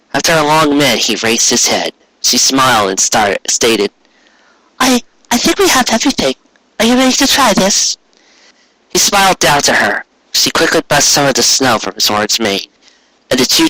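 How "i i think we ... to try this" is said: put-on a shy, hopeful young mare; Cupcake's voice. Cupcake speaks to Carrot.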